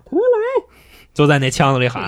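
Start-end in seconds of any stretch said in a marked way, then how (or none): 0.0-0.6 high, long drawn-out call; summoning call. Retold or imitated. Tiger Immortal calling his kicked-away head back, high cry. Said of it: "虎力大仙也这么喊".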